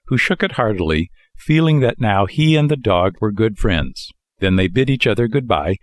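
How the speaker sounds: ordinary delivery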